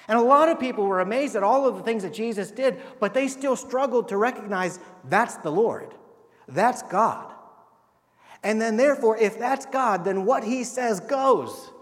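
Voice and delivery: loud, high, emphatic sermon climax; preaching at full force. Acted. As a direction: build to the point, slowing on what he says goes.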